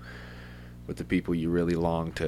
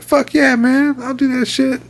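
much higher, loud and hyped; excited; 12 dB louder than usual. Retold or imitated. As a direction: shout it, all pumped up. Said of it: "and I was like".

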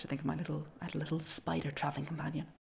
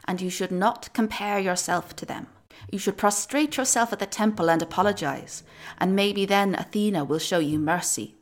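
put-on old woman voice; 3 semitones higher, 7 dB louder than usual. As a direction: warn her like a stern crone. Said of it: "said an old woman in the crowd".

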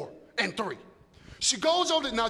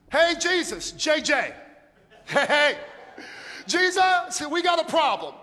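loud, urgent calling out; Mary calling; 5 semitones higher, 5 dB louder than usual. Acted. Mary calling urgently to Jesus about the wine. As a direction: wave him down across the room. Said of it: "Mary's like".

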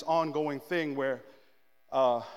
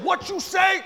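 loud, high, retold challenge; confrontational shout; 13 semitones higher, 9 dB louder, slower than usual. Preacher catches up to driver, confrontational yell.

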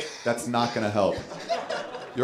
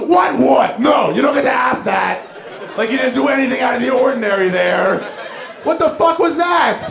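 much higher and loud, incredulous shout; friend shouting; 11 semitones higher, 4 dB louder than usual. Retold or imitated. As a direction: schreeuw het ongelovig uit, veel hoger. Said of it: "And she goes".

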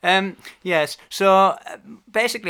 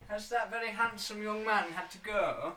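Alan Bennett impression; 9 semitones higher than usual. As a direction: play a fussy, gentle Alan Bennett. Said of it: "why are you doing my accent there".

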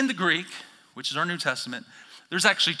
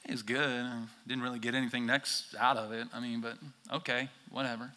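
softer, fading, lukewarm mumble; unimpressed shrug; 4 semitones lower, 5 dB quieter than usual. Retold or imitated. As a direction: trail off, not impressed. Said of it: "you're gonna be like".

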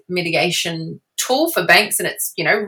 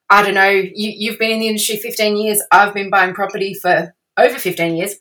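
usual speaking voice

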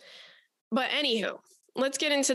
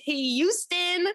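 higher, slow, drawn-out greeting; mock casual hail; 6 semitones higher, much slower than usual. Host casually hails Houston as the astronaut, slow breezy greeting. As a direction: sing out a slow breezy hello. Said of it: "And I'm like".

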